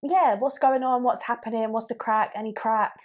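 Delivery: upbeat string of greetings; lively greetings. Shown as a demonstration. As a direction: fire off the greetings brightly. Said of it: "demo greetings".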